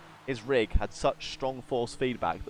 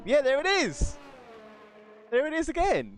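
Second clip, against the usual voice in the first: much higher, loud delighted cry; excited; 3 dB louder than usual. Real, not acted. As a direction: point it out with glee. Spotting tumbleweed on stream.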